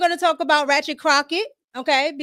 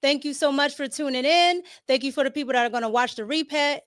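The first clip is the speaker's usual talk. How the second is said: ordinary delivery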